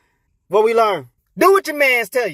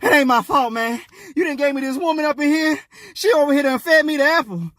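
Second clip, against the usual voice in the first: defensive blaming; 7 semitones higher than usual. Acted. Adam blames the woman to God, defensive. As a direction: protest and shift the blame.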